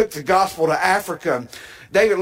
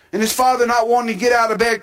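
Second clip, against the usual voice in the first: ordinary delivery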